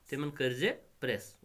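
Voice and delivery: ordinary delivery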